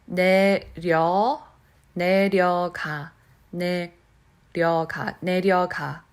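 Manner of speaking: 내려가 said slowly, tongue tapping the roof; slow careful 내려가 with a light tongue tap; slow tapped demo. Shown as a demonstration. Teacher showing learners the slow way, slow tapped 내려가. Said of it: "if I say slowly".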